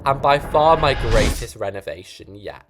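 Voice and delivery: ordinary talk, nothing marked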